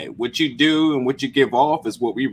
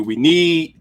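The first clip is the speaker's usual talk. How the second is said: "need" pushed higher and held; stress on need; 5 semitones higher, 3 dB louder than usual. Real, not acted. Host telling his friend he's needed, stress on "need". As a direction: lean firmly on "need". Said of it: "I'm emphasizing the word need".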